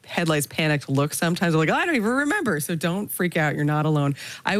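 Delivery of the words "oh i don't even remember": imitated panic, pitched much higher; mock panic. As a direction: play a deer caught in headlights, voice jumping high. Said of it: "like, a deer in headlights panic".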